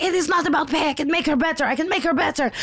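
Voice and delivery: high-pitched mock insisting, repetitive and frantic; imitated hysterical woman. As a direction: mimic an unhinged woman refusing to give up. Screaming woman argues, acted out.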